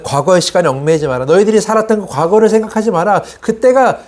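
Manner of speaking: lightly voiced leader exhorting the people; retold exhortation, rising in pitch; Moses. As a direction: urge them on, pitch climbing high. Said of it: "다시 얘기하면 모세는".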